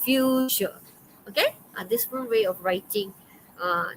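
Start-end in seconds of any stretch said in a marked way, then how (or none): 0.0-0.8 "fuchsia" drawn out as "FYOOO-shuh", raised pitch; pronunciation demo. Shown as a demonstration. Teacher naming the colour after the played model, pronunciation demo. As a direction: stretch the first syllable of "fuchsia". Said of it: "All right. It's called".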